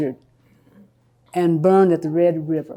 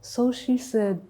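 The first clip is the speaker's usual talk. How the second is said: ordinary delivery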